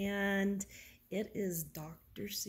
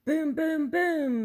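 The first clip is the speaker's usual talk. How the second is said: high, loud, resounding booms; booming thunder; 10 semitones higher, 11 dB louder, slower than usual. A narrator's reading. Reader imitates thunder for children, loud booming. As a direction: boom it out like thunder. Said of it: "Mister Brown makes thunder".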